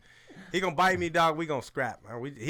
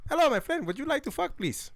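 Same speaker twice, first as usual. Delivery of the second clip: mock Indian accent; 9 semitones higher than usual. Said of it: "these Indian lions".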